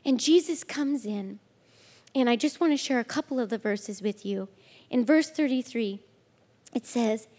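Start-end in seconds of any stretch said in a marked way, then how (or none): none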